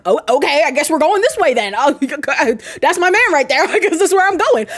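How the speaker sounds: a chirpy, upbeat Frank who can't help laughing; cheerful Frank. Retold or imitated. Frank's inner voice, voiced.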